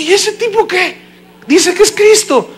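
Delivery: high-pitched comic disbelief; mock incredulity. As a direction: squeak out the astonished question. Retelling his disbelieving reaction.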